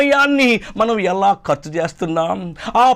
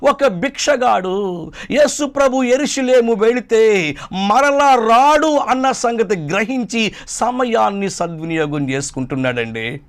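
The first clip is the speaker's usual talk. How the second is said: usual speaking voice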